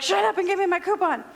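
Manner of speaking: high, fast snippy demand; mock yell. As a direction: imitate yelling at a store clerk. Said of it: "we really kinda yelled at that lady".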